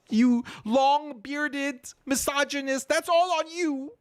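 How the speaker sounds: high, whiny mock guilt-tripping; mock accusing falsetto. Mocking reformists blaming mullahs in high whiny voice. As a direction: wag a finger in a shrill put-on voice. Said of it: "the tears start coming down".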